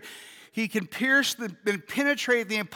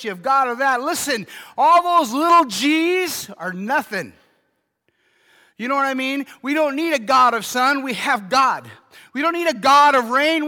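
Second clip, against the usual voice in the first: loud, higher, building preacher's cry; preaching at full force; 3 semitones higher, 5 dB louder than usual. Real, not acted. Pastor telling the church they need only one God, preaching loud. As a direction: drive it home to the church.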